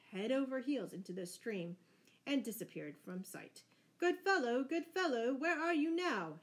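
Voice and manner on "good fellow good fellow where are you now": high, loud call after someone; anxious shout. A narrator's reading. Stranger calls to Robin fallen in the stream, shouting high. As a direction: shout down to someone who has vanished. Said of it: "shouted the stranger".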